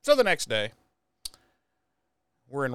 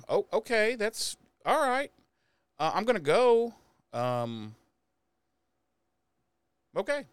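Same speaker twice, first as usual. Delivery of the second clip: higher, lightly voiced hurt girlfriend; Buffy backing away from Riley, lightly voiced higher; Buffy brushed off; 9 semitones higher than usual. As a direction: chirp it higher, covering the sting. Buffy voiced in retold scene.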